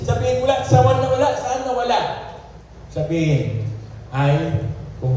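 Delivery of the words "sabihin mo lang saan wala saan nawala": high, insistent sermon questions; pitched-up, fervent preacher's challenge; urgent preaching. Real, not acted. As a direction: press the congregation for an answer.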